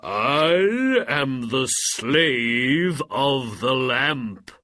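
very slow, loud proclamation; booming genie. A narrator's reading. Genie of the lamp announcing himself, booming cry. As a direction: stretch every word out grandly. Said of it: "cried the genie".

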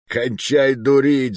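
usual speaking voice

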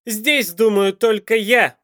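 slow, loud, high bellow; angry shout. A narrator's reading. Михаил silences his prisoner, shouting angrily. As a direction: shout each word to assert command. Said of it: "Зло выкрикнул Михаил".

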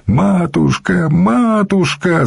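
high, drawn-out wailing cry; desperate wail. A narrator's reading. Young monk cries to the matushka, wailing. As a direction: wail it high, stretching each word. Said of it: "завопил он сходу".

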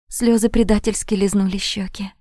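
ordinary delivery

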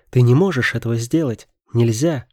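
loud pleading shout; shouting in protest. A narrator's reading. Daughter shouts Robert down, loud desperate plea. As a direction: shout it, begging him not to. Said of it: "закричала она".

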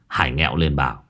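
usual speaking voice

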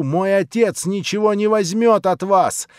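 loud, high, outraged cry; furious shout. A narrator's reading. Natasha screaming at the prince to leave her. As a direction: yell him out of the room. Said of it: "закричала ещё раз Наташа".

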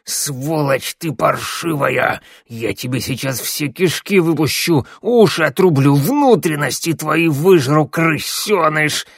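wheezing monster fury; hoarse cursing. A narrator's reading. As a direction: rasp out curses with drawn-out venom. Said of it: "стригой захрипел, осыпая его проклятиями".

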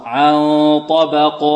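chanted عن طبق, light ب and heavy ق; ترقيق الباء وتفخيم القاف. Shown as a demonstration. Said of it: "الباء مرققة والقاف". (ترتيل عن طبق بقاف مفخمة)

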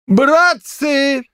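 high-pitched cry of dismay; shocked exclamation. A narrator's reading. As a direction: call out to the men, aghast, voice high. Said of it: "воскликнул потрясённый Аким Бесхлебнов". (потрясённо восклицает, высоким голосом)

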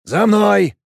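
loud, high command. Acted. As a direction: bark the order to follow. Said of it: "скомандовал". (громкая команда)

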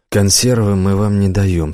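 plain reading voice

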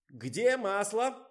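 high, loud and slow shriek. A narrator's reading. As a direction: shriek the question across the room. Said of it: "завизжал Николай". (пронзительно визжа, громко и медленно)

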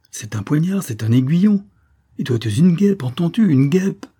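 exasperated scolding. A narrator's reading. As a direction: hammer out each charge with mounting pitch. Pricked lieutenant scolds Colibri. (reproche exclamatif, exaspéré)

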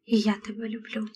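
very soft, unvoiced whisper; whispered reply. A narrator's reading. Brother answering his sister's declaration of love, whispering. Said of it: "прошепотіла Шері".

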